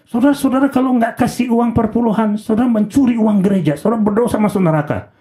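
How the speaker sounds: high-pitched, urgent imitation of a threatening preacher; scaremongering preacher. Pastor frightening his congregation about tithes, mock scaremongering preaching. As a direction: mimic a money-hungry pastor scolding the flock. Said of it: "tiap khotbah tentang duit nakutin jemaat terus".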